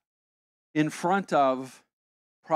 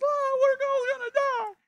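much higher squeaky panicked voice; Muppet-like panic. Retold or imitated. Colombians in the car panicking, in high Muppet voices. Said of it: "voices were raised up to the level of Muppets".

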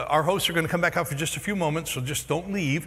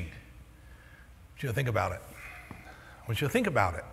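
hushed emphasis; 6 semitones lower, 9 dB quieter than usual. Real, not acted. Preacher urging the congregation to reflect, hushed. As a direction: lean in and let it sink in. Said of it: "repeated for emphasis".

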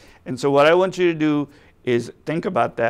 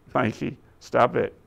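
ordinary talk

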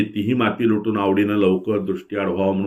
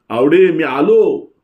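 high, slow, loud call across the yard; 8 semitones higher, 6 dB louder, slower than usual. A narrator's reading. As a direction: call Awade out to her from outside, drawn out. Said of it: "त्यानं हाक मारली".

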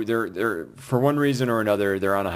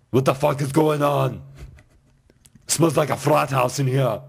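imitating Arnold; 5 semitones higher than usual. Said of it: "and then he goes".

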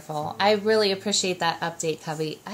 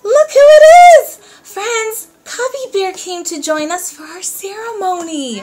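excited; 11 semitones higher, 14 dB louder than usual. Acted. Surprise puppet arrival for kids.

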